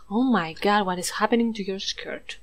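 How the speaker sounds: ordinary delivery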